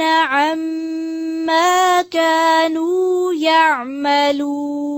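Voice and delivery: slow, melodic Quranic chanting; Quran recitation. Real, not acted. Teacher recites verses for students to follow, slow melodic chant. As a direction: draw out each verse in tajweed melody.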